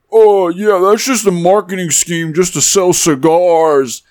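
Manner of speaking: high, loud mocking whine of a know-it-all commenter; high, loud mock voice of an online skeptic. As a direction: sneer it like a dismissive troll.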